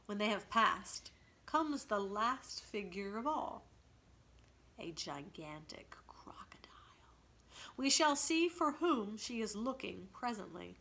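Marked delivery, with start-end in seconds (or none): 4.8-6.7 soft, lower whisper; breathy reveal of the beast. A narrator's reading. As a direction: hush it to build dread. Narrator hushes to introduce crocodile.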